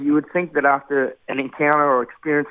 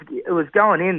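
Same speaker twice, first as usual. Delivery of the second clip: ordinary delivery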